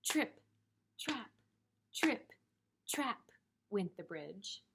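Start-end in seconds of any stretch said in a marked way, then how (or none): 0.0-3.3 high, crisp trip-trap; high, spaced-out hoofbeat words; little goat's footsteps. Acted. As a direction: tap out each step in a high voice. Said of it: "how to practice trip trapping".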